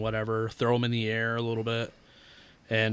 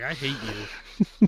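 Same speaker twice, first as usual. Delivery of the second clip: mock hate; much slower than usual. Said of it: "but I was like".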